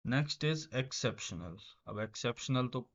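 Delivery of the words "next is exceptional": very slow, each word drawn out; slow dictation. Shown as a demonstration. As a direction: spell it out slowly for the class. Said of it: "teacher reads word slowly". (शब्द को धीरे-धीरे खींचकर पढ़ना)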